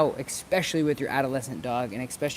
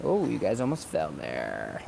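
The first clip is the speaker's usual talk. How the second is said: lower, slower startled aside; 8 semitones lower, 3 dB quieter, slower than usual. Real, not acted. Speaking to camera crew who nearly fell, startled. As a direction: react to the camera slipping.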